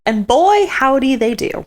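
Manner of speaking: gleeful exclaim. Real, not acted. Gleeful comment on drunk pair.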